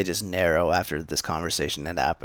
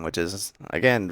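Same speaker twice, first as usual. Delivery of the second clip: plain reading voice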